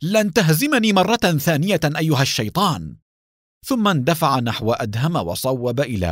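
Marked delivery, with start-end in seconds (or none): 0.0-2.9 raised, furious vow to the enemy; angry. Acted. Danny swears at his foe in anger. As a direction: spit it at him, higher and louder. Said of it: "وقال بغضب".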